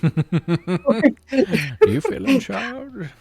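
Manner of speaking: high, slow, laughing villain impression; Bane. Worker taunting the new supervisor, drawn-out Bane voice. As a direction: stretch it out, climbing into a lilting mock menace. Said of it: "Bane quotes are usable".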